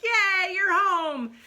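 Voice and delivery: high, loud happy cheer; overjoyed dad. Retold or imitated. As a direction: greet the son with a burst of joy. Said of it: "his dad's like".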